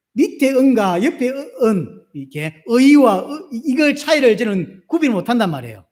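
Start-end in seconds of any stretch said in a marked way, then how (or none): 0.1-3.5 은 and 의 drawn out slowly to contrast the vowels; slow vowel demo. Shown as a demonstration. Pastor contrasting vowels for the congregation: slow demo. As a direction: spell out 은 then 의 slowly, one by one. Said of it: "최 목사님이 그렇게".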